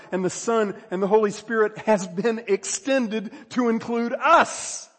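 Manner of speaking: high, loud sermon crescendo; full-force preaching. Real, not acted. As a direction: preach it with rising fervor.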